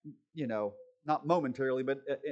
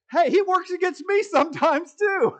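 much higher, louder jokey voice; Kevin's joking voice; 14 semitones higher, 11 dB louder than usual. Retold or imitated. Shop dog Kevin joining the joke, high and loud. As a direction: chime in like the cheeky coworker. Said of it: "Kevin said".

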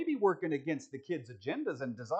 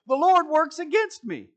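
loud punchline; 11 semitones higher, 10 dB louder than usual. Acted. Repeats punchline laughing.

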